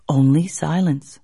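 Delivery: plain reading voice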